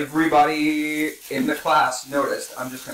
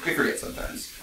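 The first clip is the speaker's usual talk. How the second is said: hushed; 2 semitones higher, 6 dB quieter than usual. Acted. Hushed dramatic aside.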